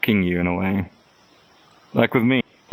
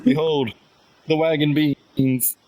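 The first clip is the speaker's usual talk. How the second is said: mock grand announcement; 4 semitones higher, slower than usual. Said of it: "presenting the beans grandly".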